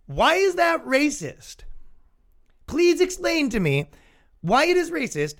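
high-pitched mock-innocent questioning; acting affronted, pitch pushed way up; indignant challenge. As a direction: demand an explanation, high and insistent. Mock debate over costume.

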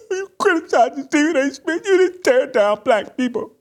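high-pitched crybaby whine; mock whining and crying. Host mimicking critics of his attack, high mock whining. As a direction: whine it in a squeaky fake sob. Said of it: "before you start whining and crying".

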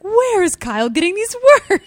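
high, loud incredulous question; laughing, exaggerated bafflement; mock bewilderment. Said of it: "I was like".

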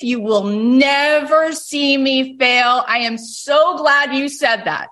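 defiant comeback. Retold or imitated.

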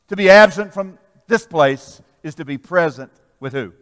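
loud, ringing preacher cadence; full-force preaching. Real, not acted. Preacher rallying the congregation, loud fervent preaching. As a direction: lift the crowd with it.

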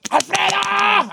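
high, long-drawn challenge yell; King Kong. Pretend. Host plays himself chest-beating outside Alfredo's place, King Kong yell. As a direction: bellow the name in mock chest-beating bravado. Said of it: "Así como King Kong".

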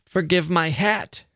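put-on high-pitched girlish plea, understated sob; childish sob. A narrator's reading. Laura to the dead man, childish tearful plea. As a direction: plead in a small, high child's voice. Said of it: "Laura gave a loud, childish sob".

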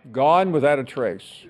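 normal talking voice

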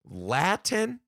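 high, rising, baffled LATIN; incredulous question. Real, not acted. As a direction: ask "Latin" in disbelief, pitching it up. Repeats it in disbelief.

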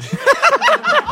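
very high-pitched laughing fit; helpless laugh. Real, not acted. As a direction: crack up at your own story. Cracking up at own story.

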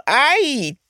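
high-pitched cry of pain, drawn out; acted scream. A narrator's reading. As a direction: yelp in pain, then stretch out a rattling cry. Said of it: "schreeuwde Purperhart".

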